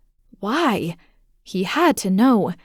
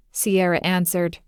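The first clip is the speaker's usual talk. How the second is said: ordinary delivery, nothing marked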